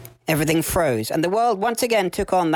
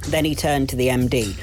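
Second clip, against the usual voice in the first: plain reading voice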